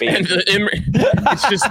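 high pitched laughing words. Real, not acted. Laughing at Facebook absurdity.

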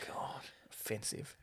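mock-offended whisper. Real, not acted. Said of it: "whispered mock offence".

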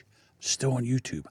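dropped low and soft, conspiratorial; hushed. Real, not acted. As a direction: keep it hushed like a secret.

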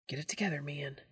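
hushed rebuke, unvoiced; whisper. A narrator's reading. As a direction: scold under your breath. Sneaking through a dark library.

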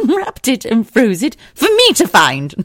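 laughing it out. Real, not acted.

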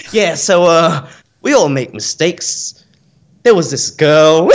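mock bogan Aussie story opener; bogan Aussie accent. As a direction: waiter confessing his story in a slow bogan Aussie accent. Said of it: "the most bogan Aussie".

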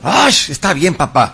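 high, loud eye-rolling "fine"; annoyed teen. Retold or imitated. Daughter huffily giving in to her scolding father, high and loud. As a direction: give in with a loud huff.

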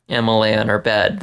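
plain reading voice

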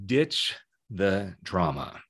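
quiet, low and deliberate; slow, emphatic sign-off. Real, not acted. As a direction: space out each word, softly. Repeats the rule as closing line.

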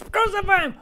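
high, loud, quick yell; mob outburst. Acted. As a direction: cut in like an angry mob. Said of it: "think of what's going on".

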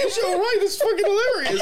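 high, giggling disbelief; mock concern, laughing. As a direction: fake worry cracking into laughter. Mocking concern while laughing.

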